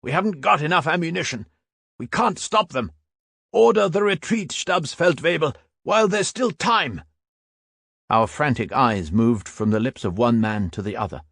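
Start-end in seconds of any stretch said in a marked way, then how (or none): 0.0-7.0 high, fast, raised pleading; urgent shout. A narrator's reading. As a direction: urge the retreat over the noise. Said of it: "shouted the veteran even louder".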